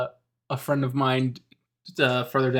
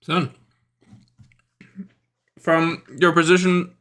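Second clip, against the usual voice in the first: usual speaking voice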